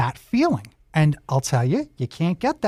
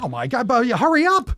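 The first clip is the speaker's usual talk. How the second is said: higher-pitched jokey rush; mock urgency; 6 semitones higher than usual. Host teasing the set builder, mock urgency. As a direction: playfully hurry someone along.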